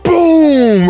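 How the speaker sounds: sudden high, loud boom; excited boom. Retold or imitated. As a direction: burst out like an explosion. Recapping the ring going on.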